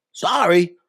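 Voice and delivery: lightly acted roaming customer; mock stranded caller. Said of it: "imagine you're in Europe and you're like".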